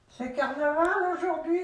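high, teasing question. Acted. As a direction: poke fun at the costume. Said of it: "il se moquait un peu de lui".

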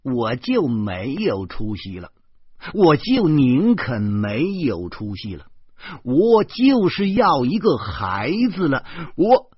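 slow and heavy, building to a loud, high shout; furious, each clause pushing harder; angry outburst. A narrator's reading. 诗谦 snaps back at his friend, building from slow anger to a shout. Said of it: "诗谦恼怒地站起来了".

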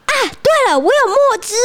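high, excited sudden idea; bright idea. Acted. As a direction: pop out with an idea. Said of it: "他又说". (同学想到办法，兴奋地说)

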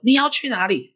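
high, urgent demanding question; acted-out pressing interrogation; retold exasperated father. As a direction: play a dad at his wits' end. Father demands again where child goes.

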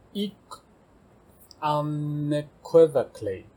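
unequivocally with stress on the EQ syllable, slow; stress on equi. Shown as a demonstration. Teacher shows where unequivocally takes its stress. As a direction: say unequivocally slowly, hitting the EQ part. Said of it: "重音常打在Q那边".

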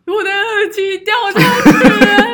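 mock-sobbing to her boyfriend, slow and loud; imitating sobbing. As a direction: wail it like a sobbing phone call. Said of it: "然后大哭".

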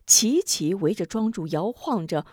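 ordinary delivery

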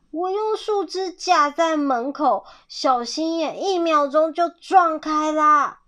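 storybook animal protesting; agitated complaint. A narrator's reading. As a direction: exclaim it with mild alarm. Animal complains of fox breaking in.

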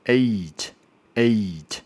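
eight drawn out slowly. Shown as a demonstration. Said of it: "乍听之下很简单". (慢慢拉长念eight)

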